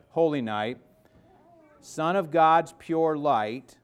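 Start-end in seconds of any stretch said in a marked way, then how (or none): none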